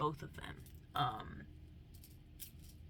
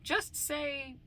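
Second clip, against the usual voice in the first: mock yell; 7 semitones higher, 7 dB louder, slower than usual. Loud retort to people.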